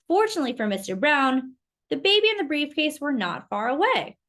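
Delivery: ordinary delivery, nothing marked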